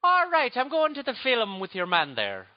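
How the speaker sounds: slow, high Irish lilt; Irish accent. Shown as a demonstration. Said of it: "the only thing I know how to say in Irish is".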